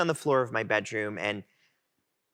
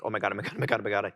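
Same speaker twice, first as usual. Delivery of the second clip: low, run-together muttered repeats; understated acted panic; retold inner panic; 6 semitones lower, much faster than usual. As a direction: mutter it to yourself in dread. Panicked inner thought as phone rings.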